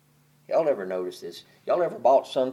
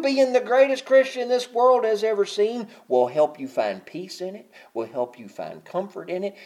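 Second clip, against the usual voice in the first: softening to comfort; 7 semitones higher, 3 dB louder than usual. Acted. Voice drops to soft comfort.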